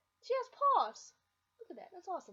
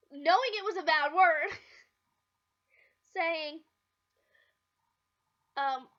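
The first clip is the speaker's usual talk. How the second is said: ordinary talk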